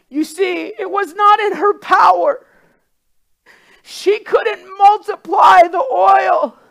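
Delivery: loud, high, crying sermon climax; preaching at full force. Acted. Preacher crying out the widow's prayer to God. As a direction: preach it, near tears and shouting.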